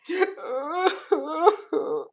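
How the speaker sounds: a high, half-laughing fake sob; mock sob-laugh. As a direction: ham up a fake wail through laughter. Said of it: "I'm making fun of those people".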